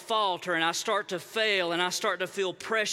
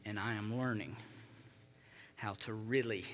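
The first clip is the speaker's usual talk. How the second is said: much softer and lower, gentle confession; quiet intimate preaching; 9 semitones lower, 8 dB quieter than usual. Real, not acted. Pastor confiding to the congregation, hushed and soft. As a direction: bring the room in close, hushed.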